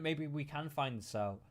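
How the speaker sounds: ordinary talk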